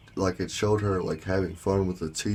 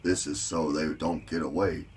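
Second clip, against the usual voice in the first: usual speaking voice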